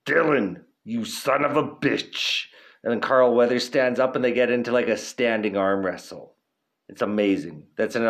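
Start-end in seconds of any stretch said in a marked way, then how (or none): 0.1-2.5 slow, put-on action-hero voice; Arnold Schwarzenegger impression. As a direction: growl it like the movie muscleman. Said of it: "Arnold walks in, and he's like".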